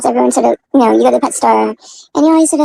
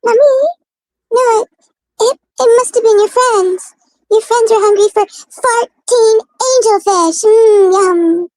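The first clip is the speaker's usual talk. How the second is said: high, slow, whimpering cat excuse; mock whiny cat; 9 semitones higher, 4 dB louder, much slower than usual. Cat pleads with owner, a high, whiny mock cry. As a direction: play the guilty cat pleading with Mommy. Cat voiced, blaming other cats.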